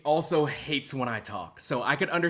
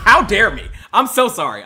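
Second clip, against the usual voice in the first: much louder, higher hammed-up apology; mock outrage at himself; 7 semitones higher, 13 dB louder than usual. Streamer loudly overplaying remorse to chat. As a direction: cry it out in fake horror. Mock self-scolding outburst.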